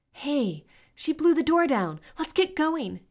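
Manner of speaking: high, loud, urgent call; rally friends to escape; excited high-pitched yell. A narrator's reading. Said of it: "yelled Crystal".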